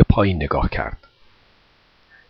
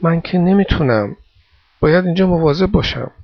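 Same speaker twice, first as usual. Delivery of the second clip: put-on higher, mildly torn boy's voice; wavering refusal, pitched up; hesitant; 6 semitones higher than usual. A narrator's reading. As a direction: decline reluctantly, unsure of yourself. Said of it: "یورگن با دودلی گفت".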